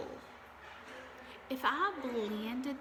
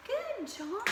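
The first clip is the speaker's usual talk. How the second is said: bright high-pitched "good job"; high praise; 11 semitones higher, 4 dB quieter than usual. Real, not acted. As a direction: cheer them on with a lift. Teacher praising the class.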